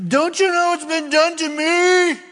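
high, loud indignant whine; mock the aggrieved victim; indignant self-pity. Voices self-pitying complainer.

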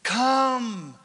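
normal talking voice

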